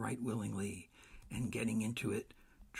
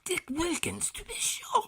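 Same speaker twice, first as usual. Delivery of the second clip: much higher, louder glad recognition; thrilled to spot his old friend; high excited voice; delighted Scrooge; 7 dB louder than usual. A narrator's reading.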